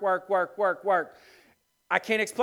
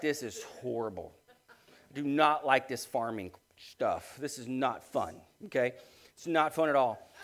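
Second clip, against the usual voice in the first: inner dismay; 9 semitones lower, 7 dB quieter than usual. Retold or imitated. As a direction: mutter it as the heartbroken farmer inside. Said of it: "I'm just like".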